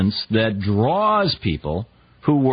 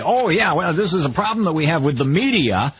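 mock pundit dodge; 7 semitones higher than usual. Host mimics evasive pundit.

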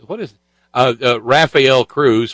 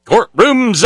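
high preacher echo; mock preacher; 7 semitones higher, 3 dB louder than usual. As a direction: mimic the pulpit cadence. Mimicking the preacher's clip.

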